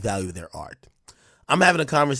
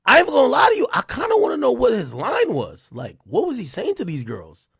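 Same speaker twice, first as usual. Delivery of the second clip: excited; 9 semitones higher, 5 dB louder than usual. Real, not acted. Curious about the guy's line.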